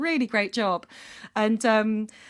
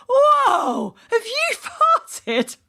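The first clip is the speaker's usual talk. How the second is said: high, loud shout of disbelief; drawn-out, appalled shout, acted; really loud exclamation; 13 semitones higher, 8 dB louder, much slower than usual. A narrator's reading. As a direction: yell it out like a stunned mechanic. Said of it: "exclaim in a really loud voice".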